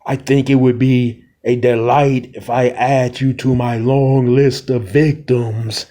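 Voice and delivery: understated menacing queen; vampire queen. Acted. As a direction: savour the threat like a predator. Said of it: "vampire queen ... is like".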